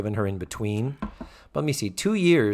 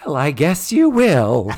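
loud, slow, swooping exit line; hammed-up put-out housemother; Mrs. Garrett impression; 3 semitones higher, 7 dB louder than usual. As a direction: drag it out as a pointed huff. Mrs. Garrett ignored by huggers.

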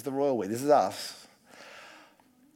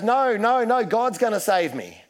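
stubborn refusal; 7 semitones higher, 4 dB louder than usual. Retold or imitated. Drowning man refusing help.

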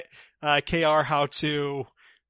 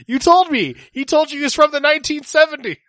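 heated yelling; 12 semitones higher, 10 dB louder than usual. Acted. Heated in-character accusation.

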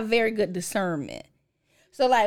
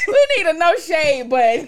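laughing; 6 semitones higher, 7 dB louder than usual. Real, not acted. Still laughing.

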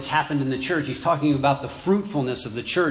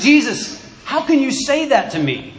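higher and louder, overcome with feeling; emotional plea; 10 semitones higher, 7 dB louder than usual. Acted. Jamie pleading with Jesus, overcome with emotion. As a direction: cry out in disbelief, close to tears. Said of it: "Jamie's filled with emotion and he starts to tear up".

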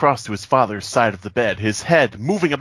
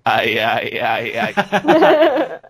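comic head-wobbling chant; goofy wobble noise; 5 semitones higher, much slower than usual. Shown as a demonstration. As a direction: build to a laughing, high yelp. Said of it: "just go".

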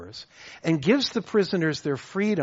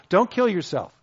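usual speaking voice

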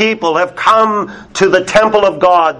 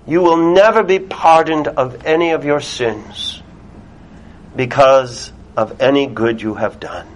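normal talking voice

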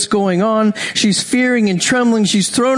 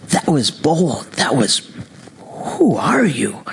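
slow, astonished whisper; awed whisper; 6 semitones lower, slower than usual. Acted. Jesus marvelling at the woman's bold faith, slow whisper. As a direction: hush it in wonder, slowly.

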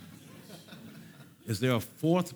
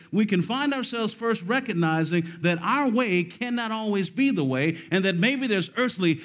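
loud, high, driving sermon delivery; rising pulpit fervor; full-force preaching; 9 semitones higher, 6 dB louder than usual. Acted. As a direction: preach it hard to the saints.

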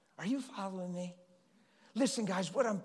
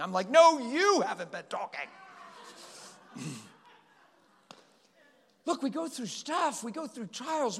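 louder, higher impassioned preaching; 6 semitones higher, 6 dB louder than usual. Acted. Pastor urging his congregation, full-force preaching. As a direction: drive the point home to the room.

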